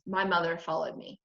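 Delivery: ordinary delivery, nothing marked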